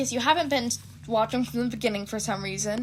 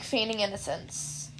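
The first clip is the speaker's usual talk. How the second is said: plain reading voice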